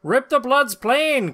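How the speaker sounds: ordinary delivery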